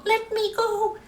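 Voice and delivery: high, squeaky shout; little man yelling to be freed; shrill leprechaun shout. A narrator's reading. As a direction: caught leprechaun crying out high. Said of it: "the leprechaun shouted".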